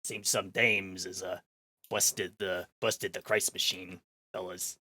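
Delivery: low, slow hardboiled gumshoe voice; lightly voiced noir detective. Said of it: "your best, like, noir voice".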